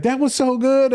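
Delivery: high, gushing lady voice, lightly played; imitating lady. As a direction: squeak it out like an impressed lady. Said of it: "the one lady was like".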